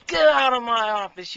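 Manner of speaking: loud, high furious yell; hammed-up furious shout; angry boss. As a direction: throw him out of the office. Angry boss throws applicant out.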